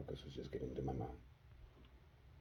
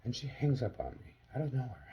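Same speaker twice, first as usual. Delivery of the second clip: ordinary talk, nothing marked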